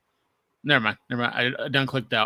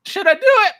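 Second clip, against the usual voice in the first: high mock question; 3 dB louder than usual. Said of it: "And I was like".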